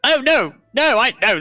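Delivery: pixie. Acted. GM voicing the NPC.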